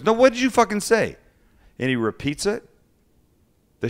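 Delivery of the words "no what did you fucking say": much higher, louder challenge; enraged chef. Retold or imitated. Chef confronting the cook who defended his coworker, enraged. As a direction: dare him to say it again. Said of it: "He goes".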